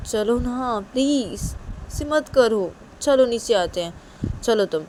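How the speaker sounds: high, insistent, whiny coaxing; pleading. Acted. Uma pleads with Sanju to come. (ऊँची आवाज़ में मिन्नत करते हुए)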